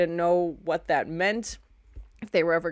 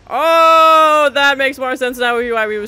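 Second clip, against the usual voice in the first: loud, high, drawn-out gasped "oh"s; blown-away viewer reaction; shocked outburst; 6 semitones higher, 14 dB louder, much slower than usual. Real, not acted. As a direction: react aloud in stunned shock.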